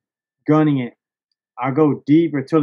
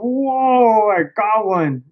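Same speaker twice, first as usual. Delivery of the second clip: higher, thrilled announcement; excited whoa; 6 semitones higher, much slower than usual. Retold or imitated. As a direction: tell your buddy you landed it. Pulling the fish up.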